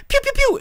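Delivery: quick high, loud "pew" shots; gunfire noises. Retold or imitated. Hitman shoots casually in every set, high mock gunfire. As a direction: spit out cartoon gunshots.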